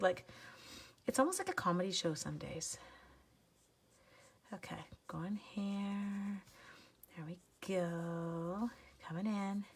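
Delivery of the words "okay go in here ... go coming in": slow hushed whisper while coloring; whispering to herself. Real, not acted. Crafter colors on stream, whispering slowly to herself. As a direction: murmur it under your breath as you work.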